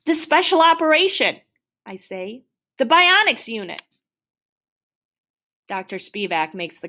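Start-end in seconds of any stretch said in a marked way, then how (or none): 0.1-1.4 high, loud, exasperated retort; upset insistence. Acted. Boy insists to doctor, upset. 2.8-3.8 high, exasperated retort; upset insistence. Acted. Boy insists to doctor, upset.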